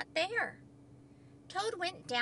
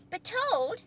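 high, friendly Frog voice; cheerful Frog; 8 semitones higher, 4 dB louder than usual. A narrator's reading. Frog reassures worried Toad, cheerful and high. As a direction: gently protest in a lifted voice.

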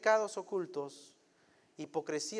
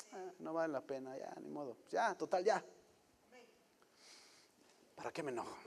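calm letting go; 7 dB quieter than usual. Acted. The wise man lets it go.

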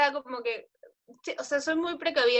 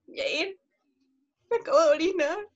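put-on dramatic drunk call; 5 semitones higher than usual. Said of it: "la más dramática".

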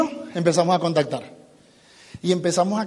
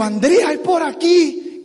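raised, drawn-out excited announcement; excited discovery; 7 semitones higher, much slower than usual. Retold or imitated. Telling Andrea he found the way, excited. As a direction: announce a find to a partner, stretching it out.

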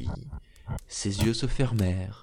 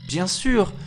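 quick, higher, louder reply; brisk wife; 8 semitones higher, 5 dB louder than usual. Acted. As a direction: cut in briskly to cover. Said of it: "dit la femme vivement". (épouse vive, plus aiguë)

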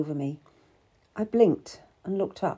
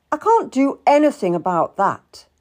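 loud, higher protest; 6 semitones higher, 8 dB louder than usual. A narrator's reading. Seymour refuses to help the prisoner, protesting. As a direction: refuse with emphatic exasperation. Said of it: "Edward Seymour protested".

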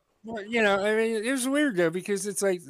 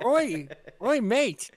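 high, put-on British hail; mock British accent; 4 semitones higher, much slower than usual. American repeating a friend's British accent, pitched up. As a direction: call it out in imitation British. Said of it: "do an accent of that type".

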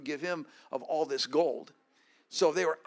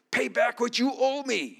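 demanding; 7 semitones higher, 4 dB louder than usual. Acted. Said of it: "he demanded".

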